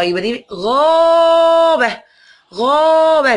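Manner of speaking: غابة sung out high and drawn long; teacher stressing غابة high and slow; emphatic model word. Shown as a demonstration. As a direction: lift and hold غابة for the class.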